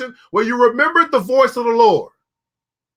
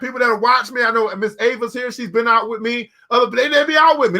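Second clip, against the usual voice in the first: ordinary delivery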